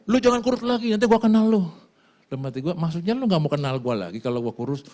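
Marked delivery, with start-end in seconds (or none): 0.1-1.7 imitating friend.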